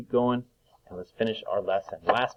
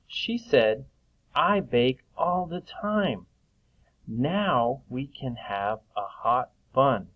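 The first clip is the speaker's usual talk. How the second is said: slow reading aloud; 3 semitones higher, much slower than usual. Shown as a demonstration. Teacher reads story sentence aloud.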